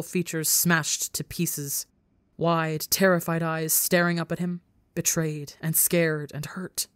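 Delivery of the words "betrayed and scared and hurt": ordinary delivery, nothing marked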